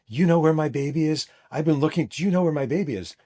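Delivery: imitating a frantic, crying mother; retold sobbing scream. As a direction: plead desperately, repeating it, without a full scream. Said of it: "sobbing over the phone and screaming over the phone".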